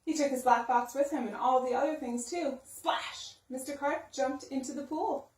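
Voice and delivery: ordinary delivery, nothing marked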